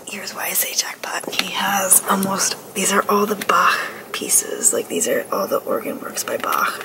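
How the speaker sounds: hushed excited whisper in a church. Real, not acted. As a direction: whisper so the church stays quiet.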